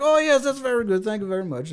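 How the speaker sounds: put-on high foreign-accented politeness, easing down at the end; hammed-up foreign accent, eagerly grateful; Borat. Talking with a local, mock Borat-style foreign accent. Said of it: "that stupid Borat impression".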